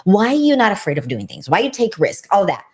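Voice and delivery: normal talking voice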